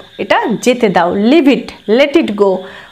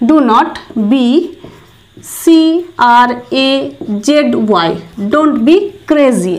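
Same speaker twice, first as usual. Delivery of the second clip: very slow, word by word with long pauses; teacher dictation pace; slow spelled-out demo; much slower than usual. Shown as a demonstration. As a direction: break it down for students. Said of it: "তো এটার জন্য বলবো".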